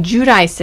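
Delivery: Giudice said "joo-dice"; revived older pronunciation. Shown as a demonstration. Host tracing the change back, Giudice as "joo-dice". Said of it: "now they're saying Giudice again".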